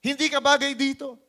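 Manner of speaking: loud, high, harsh put-down; scolding big brother. Acted. Older brother telling David he doesn't belong, loud and harsh. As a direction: shove him away with the words.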